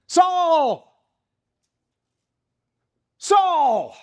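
high, loud divine call ringing out; booming voice from heaven. Acted. Jesus calls out to Saul on the road, loud and high. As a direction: call his name down from the sky, loud. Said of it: "he heard a voice that said".

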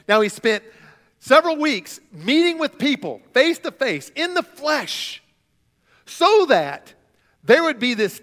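building sermon. Acted. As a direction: build the point with fervor.

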